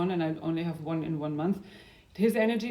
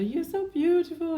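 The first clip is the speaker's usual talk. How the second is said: mock-gushing, pitched way up; imitating a fan's sugary praise; gushing compliment; 10 semitones higher than usual. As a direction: coo it like an admiring stranger. People complimenting her, quoted.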